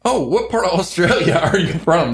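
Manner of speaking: high, mock-eager question with a laugh; imitating curious Americans. American mistaking an Irish visitor for Australian, imitated curiosity. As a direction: pitch it up as a clueless local. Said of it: "they'll get".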